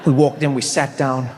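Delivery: ordinary talk, nothing marked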